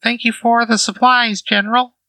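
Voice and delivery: very high, squeaky talking housecat; tiny high cat addressing a general; high-pitched cat voice. Acted. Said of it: "it sounds like a high-pitched voice".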